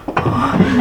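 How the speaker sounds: imitated sharp gasp of anticipation; sharp intake of breath, acted; excited gasp. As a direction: gasp like a child bursting with excitement. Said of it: "and he's like".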